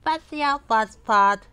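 high, loud mocking call; name-calling taunt. People calling Mister Fussy names, high taunting. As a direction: taunt him with a nickname. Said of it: "people used to call him".

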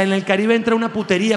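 ordinary delivery

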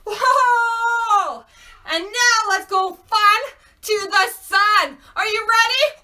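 high, loud, thrilled host voice; excited hype. Pretend. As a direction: cheer them on to the next trip. Hyping children for next trip.

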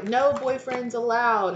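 high, slow, drawn-out decree; mock bossy rule. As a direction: lay down the rule with mock authority. Voices single friend laying down rule.

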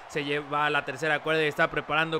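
building ringside call; wrestling commentator. Acted. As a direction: hype the finisher setup. Play-by-play of finisher setup.

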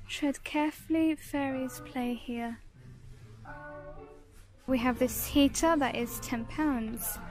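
normal talking voice

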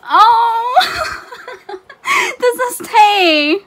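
high, loud squeal breaking into laughter; real tearful-sounding fangirl squeal; overwhelmed delight. Real, not acted. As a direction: wail it slowly, laughing with joy. Overwhelmed by an unexpected gift.

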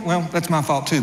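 normal talking voice

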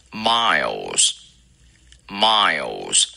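miles slow with a dark L; teacher stretches miles. Shown as a demonstration. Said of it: "dark L".

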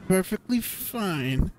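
high, drawn-out, uneasy insistence; anxious character putting on a brave front; nervous reassurance. Acted. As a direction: slowly squeeze out an unconvincing, high-pitched assurance.

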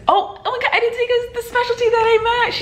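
high, rushed burst of glee; can barely contain herself; excited. Real, not acted. Announcing her specialty to viewers, giddy and high-pitched.

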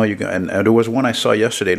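usual speaking voice

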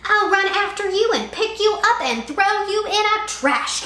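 high loud bully threat; hollering threat. A narrator's reading. Sammy threatens Kevin, loud bully holler. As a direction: rant like an angry kid. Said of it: "Sammy hollered".

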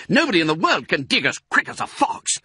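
fast, high, boastful cry; excited shout. A narrator's reading. Mister Fox urging on the diggers, excited shout. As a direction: rattle it off exultantly, pitched high. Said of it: "shouted Mister Fox".

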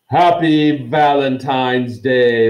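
big drawn-out announcement; shouted greeting. A narrator's reading. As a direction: call it out grandly to a whole hall. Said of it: "Lockhart shouted".

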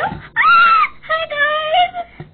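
very high loud laughing shriek then greeting; excited squeal. Acted. Fan crashes the video, high laughing shriek hello. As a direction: burst in screaming hello to the viewers.

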